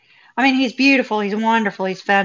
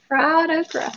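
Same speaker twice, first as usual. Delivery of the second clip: amused; 7 semitones higher than usual. Real, not acted. Repeating the words while amused.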